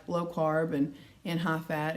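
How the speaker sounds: ordinary delivery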